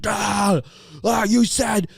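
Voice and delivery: high, shouted mock rant at his own reflection; frantic high-pitched yelling impression; ranting junkie. Imitating a man screaming at himself.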